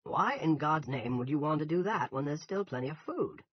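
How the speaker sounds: heated, incredulous challenge; angry. A narrator's reading. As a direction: demand an answer angrily. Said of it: "Ernie asked angrily".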